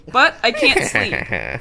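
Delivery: hammed-up melodramatic confession; mock dramatic. Vampire confessing to his girlfriend, hammed-up mock drama.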